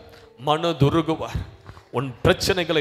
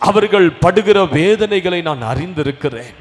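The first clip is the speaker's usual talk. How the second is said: loud, higher, fervent proclamation; preaching at full force; 6 semitones higher, 11 dB louder than usual. Acted. Preacher promises the congregation deliverance, loud and fervent. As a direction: pour out the promise with rising fervour.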